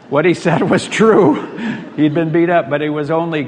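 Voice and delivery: can't hold back the laugh telling it; real amused laughter while speaking. Real, not acted. Laughs at own playground story.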